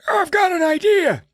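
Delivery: put-on high, strained old man voice. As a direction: croak it out like a frail old man. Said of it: "he's like".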